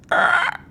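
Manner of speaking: very high, loud squawking cry; bird-like squawk. Acted. A Kenku answers the call with a high squawk. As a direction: screech like a startled bird. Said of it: "one of the Kenku go".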